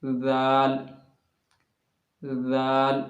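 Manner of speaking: जाल with the tongue tip slightly out, light and thin; thin zaal. Shown as a demonstration. Teacher demonstrating the letter, light and thin. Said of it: "बाहर करने के बाद बोलना है".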